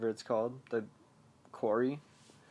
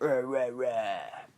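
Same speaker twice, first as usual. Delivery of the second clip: slow, drawn-out imitated roar; mock monster snarl; much slower than usual. Clown baring protruding teeth at Richie, mock snarl. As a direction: stretch out playful monster rahs.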